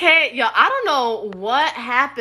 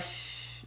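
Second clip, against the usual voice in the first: an unvoiced "shh" hiss; shushing; 22 dB quieter than usual. Reader mimics the song's shush to viewers, unvoiced. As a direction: hush them with a soft shh. Something like with your mom was like.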